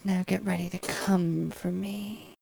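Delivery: hushed whisper right in your ear. Acted. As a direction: breathe it close, barely voiced.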